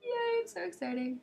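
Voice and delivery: high mock-cheery yay; a high, hollow sarcastic yay; sarcastic cheer. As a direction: fake excitement, dripping irony. Sarcastic cheer about bad news.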